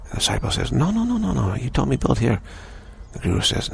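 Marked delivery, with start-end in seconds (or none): 0.7-2.4 much higher, frantic denial in a retold voice; a disciple's panicked no, pitched way up; shocked protest. Disciple protests to the guru that he was told to build here, shocked and much higher.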